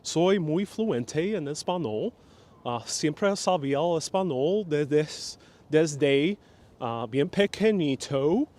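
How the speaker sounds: put-on Spanish in a thick American accent; slow, drawn-out Spanish mangled with American vowels; joke American accent. Messing with people who ask if he speaks Spanish, heavy American accent. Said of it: "that's why I do that accent".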